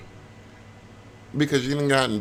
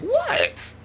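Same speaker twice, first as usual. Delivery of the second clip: very high, shocked squeal; incredulous squeal. Real, not acted. Reacting to the rude sim with a high squeal. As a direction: squeal it in disbelief.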